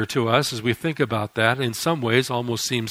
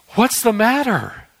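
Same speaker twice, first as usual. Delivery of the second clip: high, slow, concerned wife's question; worried wife; 9 semitones higher, 4 dB louder, slower than usual. Acted. Wife asking her breathless husband: high and concerned. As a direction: ask it like a startled wife, drawn out.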